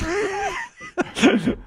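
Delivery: squealing high-pitched laugh; real laugh at the memory. Real, not acted. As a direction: burst into a high cackle. Laughing at divorce advice.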